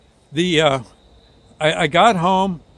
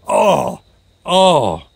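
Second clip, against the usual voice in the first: pained groan; 7 dB louder than usual. Retold or imitated. Fingers crushed in the door.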